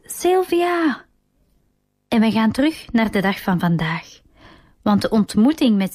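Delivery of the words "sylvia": high ringing call of her name; loud call. A narrator's reading. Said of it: "roept nogmaals luid".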